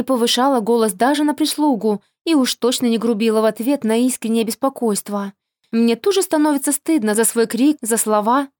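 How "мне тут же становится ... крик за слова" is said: ordinary delivery, nothing marked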